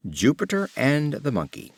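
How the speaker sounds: ordinary delivery, nothing marked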